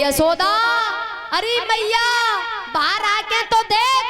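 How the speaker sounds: high calling voice to someone indoors. Acted. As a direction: call Yashoda out of the house. Gopi calls out to Yashoda. (ऊँची आवाज़ में पुकारना)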